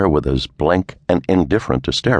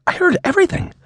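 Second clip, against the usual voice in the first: put-on much higher gleeful young woman; Clara's voice; faster than usual. A narrator's reading. Secretary telling her boss she overheard, much higher and gleeful. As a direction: spill it out gleefully.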